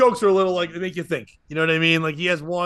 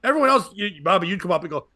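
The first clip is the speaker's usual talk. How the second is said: ordinary talk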